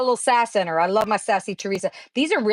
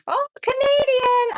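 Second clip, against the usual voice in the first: surprised realization; slower than usual. Real, not acted. Reacting to a chat comment.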